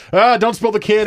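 high, loud mock worry; mock panicked. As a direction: fret about spilling, hammed up. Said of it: "playing the game of".

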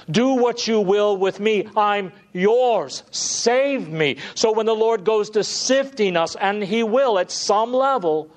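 high, loud pleading sermon voice; full-force preaching. Acted. Preacher pleading before his congregation, full force. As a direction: preach it out with urgent fervour.

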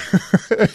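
real high-pitched laughter; high giggle. Real, not acted. Laughing at own story.

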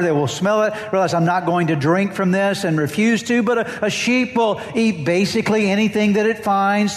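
preaching at full force. Acted. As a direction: drive the point home from the pulpit.

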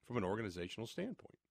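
ordinary talk

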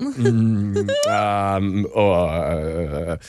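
low, drawn-out hesitant fillers; awkward stalling. Retold or imitated. Dad cornered by stepdaughter's question, awkwardly stalling. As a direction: stall for time, lost for an answer. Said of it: "this is where it gets awkward".

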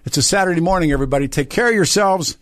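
radio host signing off to listeners, upbeat and high. Real, not acted. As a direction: wrap up the show with energy.

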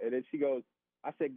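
ordinary delivery

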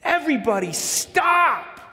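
sudden high-pitched shout; yelled. Retold or imitated. Overwhelmed dad to his noisy family, yelling. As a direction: yell to halt all the noise at once. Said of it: "I just yelled".

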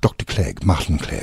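low-pitched British character accent; put-on British accent. Shown as a demonstration. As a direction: say it in a deep British accent for the role. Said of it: "are you doing the accent now".

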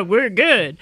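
lightly voiced ventriloquist puppet; barely changed puppet voice; imitating Todd Oliver. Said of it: "He would say".

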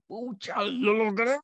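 high-pitched mock triumph; acted-out burst of self-congratulation; celebrating. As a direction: cheer it like a small personal victory. Acts out celebrating no hangover.

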